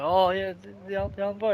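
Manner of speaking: a hint of a Welsh accent; lightly voiced Welsh old man. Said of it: "he speaks fluent Welsh".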